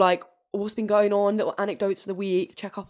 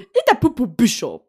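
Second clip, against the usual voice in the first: segment shout; 5 semitones higher, 9 dB louder than usual. Pretend.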